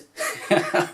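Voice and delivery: breathy unvoiced giggle at his own line; a quick laugh that escapes him; laughing at own joke. Real, not acted. Host laughing at his own joke to viewers, giggle. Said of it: "make myself laugh".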